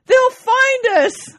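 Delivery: very high, loud, slow hammed-up cry; punchline shouted with mock joy. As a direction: shout it in triumph, drawn out. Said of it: "He says".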